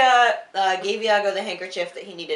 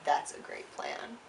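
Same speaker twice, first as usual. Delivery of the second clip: dazed whisper; 4 semitones lower, 10 dB quieter than usual. Retold or imitated. Othello agreeing to Iago's plan, a drained whisper. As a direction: barely breathe it after the seizure. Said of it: "Othello is like".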